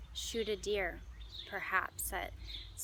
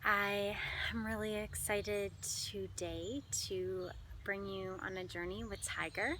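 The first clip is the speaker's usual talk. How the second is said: normal talking voice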